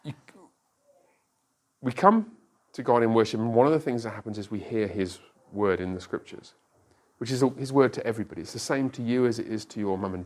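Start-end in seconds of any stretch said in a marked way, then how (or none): none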